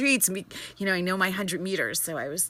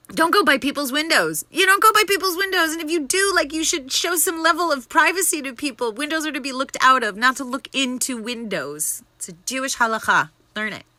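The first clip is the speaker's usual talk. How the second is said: loud, high, scolding rant; exasperated lecture at full volume; angry rant; 7 semitones higher, 5 dB louder than usual. Real, not acted. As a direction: scold the window-peepers hard. Ranting at people at windows.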